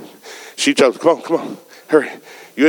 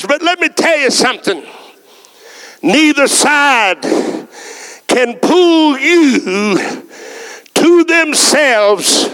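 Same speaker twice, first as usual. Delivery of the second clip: rising sermon delivery with slow drawn-out peaks; preaching; 13 semitones higher, slower than usual. Acted. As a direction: preach it, stretching the key words.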